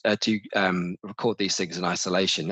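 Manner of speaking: ordinary delivery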